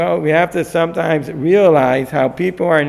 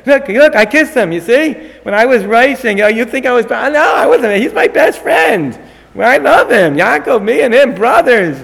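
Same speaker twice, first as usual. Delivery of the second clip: high, eager protesting voice; fake innocent denial; 5 semitones higher than usual. Esav insisting to the audience he loves Yaakov, high and eager. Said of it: "Esav, "I wasn't trying to bite him".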